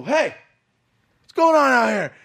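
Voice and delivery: loud high call across the field. Retold or imitated. Shouting toward the field on Thanksgiving, loud and high.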